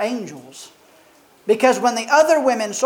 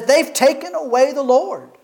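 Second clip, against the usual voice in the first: higher, pleading with grief; a grieving woman's higher plea; distraught Mary; 5 semitones higher than usual. Acted. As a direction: voice Mary's heartbreak, pitched up. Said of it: "she says".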